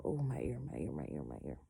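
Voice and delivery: fading, pained moan about her ear; real earache moan trailing off. Real, not acted. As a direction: let the ache drain the voice away. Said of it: "oh, it hurts".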